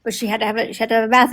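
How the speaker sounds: ordinary delivery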